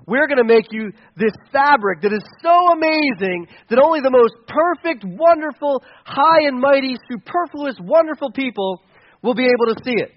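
lightly hammed-up swindler's patter; a hint of the flattering con man; charlatan sales pitch. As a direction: sell it grandly, punching each lavish adjective. Charlatans pitching fabric to emperor.

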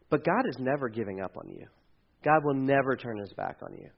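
normal talking voice

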